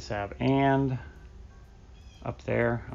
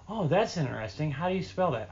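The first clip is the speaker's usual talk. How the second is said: higher-pitched imitation of a customer; curious customer; 5 semitones higher than usual. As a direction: play the interested customer asking. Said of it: "And they're like".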